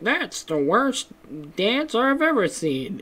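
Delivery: slow, high, nasal-free cartoon sneer; imitating Squidward. Squidward mocking SpongeBob's dancing, in a slow cartoon sneer. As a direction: drag it out like a sour cartoon squid. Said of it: "and he's like".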